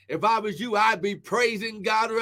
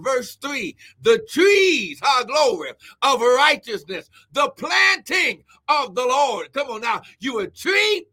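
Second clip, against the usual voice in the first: building, forceful scripture preaching; impassioned sermon cadence, getting louder; fervent preaching; 5 dB louder than usual. Real, not acted. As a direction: proclaim each phrase to the congregation, rising to shouts.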